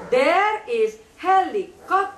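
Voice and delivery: "There is helicopter" slowly, each syllable set apart. Shown as a demonstration. Teacher shows child how to say it, syllable by syllable. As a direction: model "There is helicopter" in slow, separated beats. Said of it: "listen to me".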